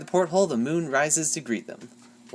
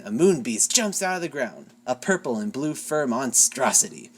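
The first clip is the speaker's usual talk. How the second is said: usual speaking voice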